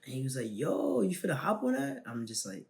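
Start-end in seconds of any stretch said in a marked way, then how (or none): 0.5-2.0 friend egging on. Retold or imitated.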